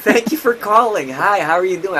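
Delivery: bright, high, pleasant phone greeting; sweet high customer service voice. Retold or imitated. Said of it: "I had a higher pitched voice".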